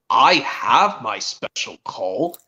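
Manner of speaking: a hint of a cartoon train, drawn out; Henry. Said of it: "Henry tries to tell Thomas and Victor".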